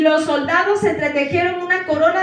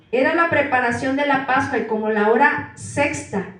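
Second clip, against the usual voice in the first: ordinary delivery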